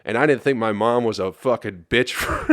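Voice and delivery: ordinary talk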